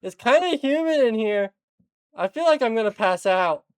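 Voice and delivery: muffled, as if under a coat. Acted. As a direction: speak through cloth, muffled. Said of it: "you just hear [name]'s muffled voice".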